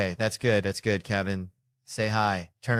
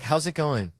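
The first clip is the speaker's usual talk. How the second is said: pandering greeting; 8 semitones higher than usual. Retold or imitated. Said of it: "He's like".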